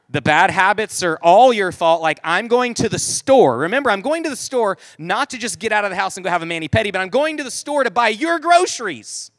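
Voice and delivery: emphatic retold scolding; scolding wife. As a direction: tell him off, leaning hard on key words. Wife scolds husband, acted out.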